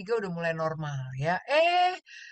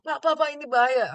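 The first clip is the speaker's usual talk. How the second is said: high, pressing alarm to the patient; urgent warning; 8 semitones higher than usual. Retold or imitated. Doctor urgently warns patient it's dangerous, high and pressing. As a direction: rush the warning, voice up.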